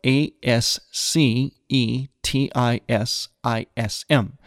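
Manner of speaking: slow, letter-by-letter spelling of ASCETISM; measured spelling with each letter separate. Shown as a demonstration. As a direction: spell it out slowly, one letter at a time. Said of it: "Now, it's spelled".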